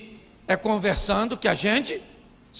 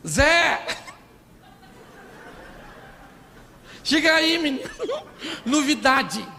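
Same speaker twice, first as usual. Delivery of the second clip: mock calling out; 6 semitones higher than usual. Maria calling Zé over to tell him news, hammed-up holler.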